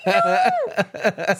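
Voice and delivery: huge high gasp into a squealed cry; hammed-up retold panic; freaking out. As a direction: gasp in horror, then wail it very high. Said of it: "freaking out".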